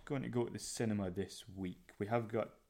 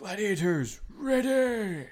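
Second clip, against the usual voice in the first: booming game show announcer; announcer shout; 11 semitones higher, 8 dB louder, much slower than usual. Retold or imitated. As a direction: call it out like a TV host.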